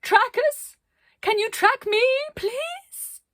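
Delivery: very high, loud pleading calls; desperate calling. Acted. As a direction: plead into the storm. Arcadia calling lost group.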